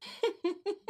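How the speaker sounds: high-pitched laughing burst; gleeful laugh. Acted. Prince amused with his mother, high gleeful laugh. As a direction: laugh in triumph.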